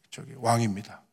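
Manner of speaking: ordinary delivery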